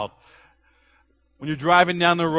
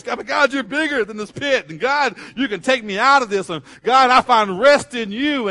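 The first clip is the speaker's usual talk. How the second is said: high, loud burst of praise; David turning from lament to praise; rising praise; 8 semitones higher, 6 dB louder than usual. Retold or imitated. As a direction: lift it into triumphant praise. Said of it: "You can see it in his voice".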